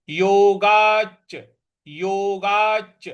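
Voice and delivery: योगाच with a long held च, slow; doubled च. Shown as a demonstration. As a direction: lean into the च of योगाच. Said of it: "फिर पूरा च है".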